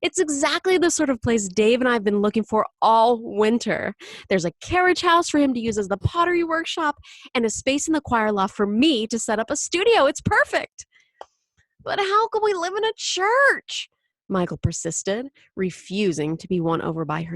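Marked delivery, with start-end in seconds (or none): 0.0-10.9 loud, high gushing sales pitch; enthusiastic. Acted. Mother selling the new place. 11.8-13.9 put-on high, loud child's objection; protesting. Michael protesting the move.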